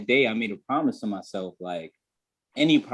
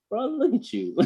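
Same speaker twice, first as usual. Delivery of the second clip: high-pitched, incredulous mirror talk; mock self-scolding; 13 semitones higher than usual. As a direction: squeak it at your own reflection in mock disbelief. Acted scolding of himself in mirror.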